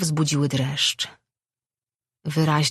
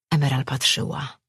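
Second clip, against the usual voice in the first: usual speaking voice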